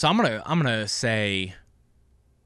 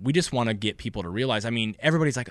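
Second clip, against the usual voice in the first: ordinary talk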